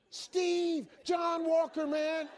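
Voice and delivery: high, loud, chirpy greeting; calling out with relentless cheer; upbeat caller. Acted. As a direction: imitate an overeager morning caller. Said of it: "he called me the exact same way".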